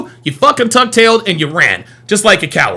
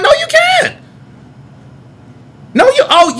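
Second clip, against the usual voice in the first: much higher, strained shout; angry yell. Real, not acted. Streamer shouting down chat, strained yell. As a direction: cut them off with a high yell. Ranting at banned viewers.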